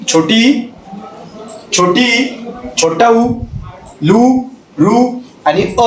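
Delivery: छोटी and छोटा उ named slowly, लू रू अ drawn out; short vowels recited. Shown as a demonstration. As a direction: say each vowel deliberately as a demo. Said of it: "आपल्या भाषेतील". (स्वर हळू स्पष्ट उच्चार)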